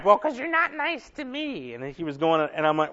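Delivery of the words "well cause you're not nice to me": imitated wife. Wife voiced in retold quarrel.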